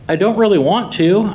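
a half-hearted yell back to mother; mild yell back. Acted. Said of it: "I yelled back".

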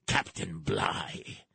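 hushed, slow whisper; whispered name; whispered aside. Acted. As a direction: breathe the name darkly. Dramatic aside on the Bounty.

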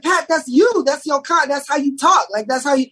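high excited insisting. Retold or imitated. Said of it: "I was like".

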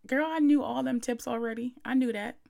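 imagined listener's retort. Pretend. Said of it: "you're saying to yourself".

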